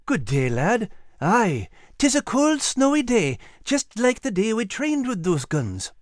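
put-on high-pitched Scottish old soldier; lilting Scottish greeting from an old soldier; genial old Scottish sergeant.

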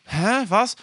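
high-pitched startled "huh"; mock sleeper's confusion. Girlfriend insisting she was asleep, mock bewildered. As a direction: imitate someone abruptly baffled.

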